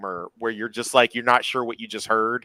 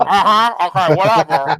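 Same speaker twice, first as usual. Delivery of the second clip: loud, high, bored brush-off; dismissive; 7 semitones higher, 10 dB louder than usual. Retold or imitated. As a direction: wave it off like you're not listening. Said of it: "She was like".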